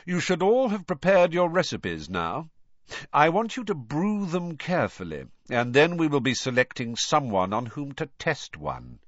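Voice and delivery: a touch of sinister schoolmaster; menacing teacher. A narrator's reading. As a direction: address the class with a veiled threat. Snape instructs class with veiled threat.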